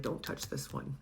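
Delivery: protective whisper, very soft; whispered warning. Retold or imitated. God warns others off the client in a whisper. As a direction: breathe it like a secret guard. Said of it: "God's like".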